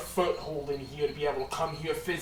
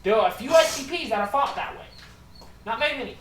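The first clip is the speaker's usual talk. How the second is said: booming npc; 10 semitones higher, 10 dB louder than usual. Acted.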